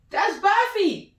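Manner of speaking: high, slow squeaky sing-song; mock high voice. Commentator mock-naming the demon goat, squeaky. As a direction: squeak out the pet name.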